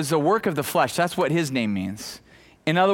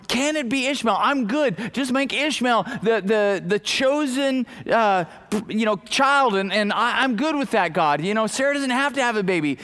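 higher, eager mock plea; imitating pleading; 6 semitones higher than usual. Abraham begging God to choose Ishmael, in a high pleading retelling. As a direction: bargain like a father begging for his son. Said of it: "he starts pleading with God".

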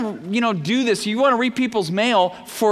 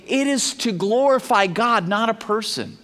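ordinary talk, nothing marked

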